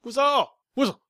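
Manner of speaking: high loud mock whistle yell. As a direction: shout the word like a whistle. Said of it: "you just say, Whistle".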